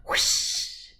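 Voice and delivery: airy voiceless whoosh of rising water; breathy whoosh. Acted. Boy coming up out of the pool, airy whoosh. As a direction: blow out a whoosh like water rushing. Splash sound effect in story.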